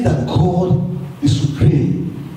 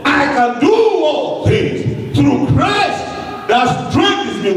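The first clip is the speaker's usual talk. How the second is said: proclaiming shout; 7 semitones higher, slower than usual. Acted. Preacher quotes Paul forcefully.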